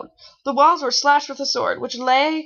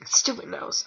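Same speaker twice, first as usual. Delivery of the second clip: annoyed mutter; 6 dB quieter than usual. Real, not acted. Annoyed at her stuffy nose.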